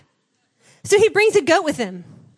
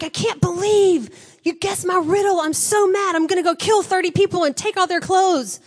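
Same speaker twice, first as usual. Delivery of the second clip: mock angry Samson; 5 semitones higher than usual. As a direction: play him as a sulking hothead, mock furious. Samson mocked after losing riddle.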